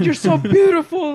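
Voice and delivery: high, loud mock consoling; hammed-up exasperated reassurance; retold urgent pleading. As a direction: imitate a friend yelling compliments to save someone. Imitates friend talking woman down.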